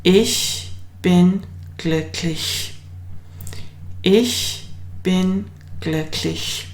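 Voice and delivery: slow clear German sentence; slow model sentence. Shown as a demonstration. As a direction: model it slowly for the class. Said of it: "So the sentence will be".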